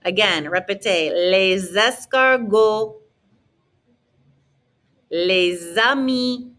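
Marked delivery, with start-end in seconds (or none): 1.3-3.0 LES ESCARGOTS slowly as lay-zes-car-go; slow LES ESCARGOTS, no final T or S; liaison, silent endings. Shown as a demonstration. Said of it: "not gonna hear the T or the S". 5.1-6.5 LES AMIS slowly as lay-za-mee; teacher's slow model of LES AMIS; silent final S. Shown as a demonstration. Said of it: "Don't pronounce the S's on the end".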